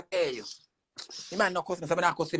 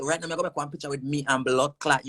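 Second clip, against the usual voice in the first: ordinary delivery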